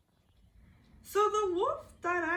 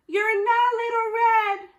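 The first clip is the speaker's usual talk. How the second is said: high, loud alarmed cry; alarmed shout; 7 semitones higher, 11 dB louder than usual. Acted. Grandma yelling at the wolf before slamming the door.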